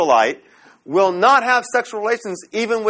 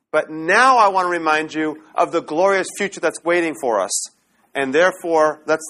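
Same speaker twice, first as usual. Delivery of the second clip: ordinary talk, nothing marked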